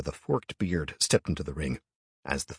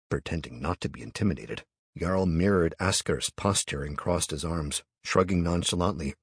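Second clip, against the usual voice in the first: plain reading voice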